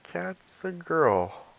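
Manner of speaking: put-on high, very slow, careful explanation to a small child; patiently correcting. Father gently correcting his three-year-old son, high and very slow. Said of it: "And I was like".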